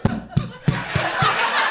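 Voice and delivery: shrieking high laughter. Real, not acted. Telling the story's climax, high shrieking laughter. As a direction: lose it at the punchline.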